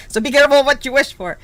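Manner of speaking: ordinary delivery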